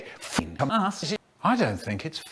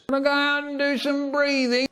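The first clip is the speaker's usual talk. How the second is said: much higher, odd-toned voice; high silly voice; 12 semitones higher than usual. Acted. Announcing a breathing break in a much higher voice. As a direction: say it like a squeaky cartoon. Example of things we do.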